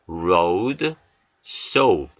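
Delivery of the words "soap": ordinary talk, nothing marked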